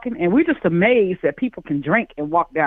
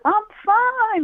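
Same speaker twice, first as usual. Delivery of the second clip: high defensive fine; 12 semitones higher than usual. Retold or imitated. Drunk insisting she's fine.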